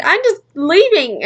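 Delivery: high, slow huffy announcement; imitating an upset walk-out; retold yell. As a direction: draw it out high, as a protest. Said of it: "I was yelling at my dad".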